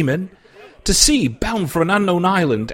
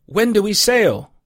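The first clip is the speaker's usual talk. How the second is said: put-on eager young question, sail rising high; eager boy; 7 semitones higher, 3 dB louder than usual. A narrator's reading. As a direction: ask breathlessly, dying to go. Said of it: "cried I".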